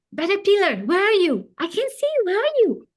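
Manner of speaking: high, loud anxious calling out; a little boy searching for his missing pet; worried child calling. Acted. Boy calling for his caterpillar, high and worried.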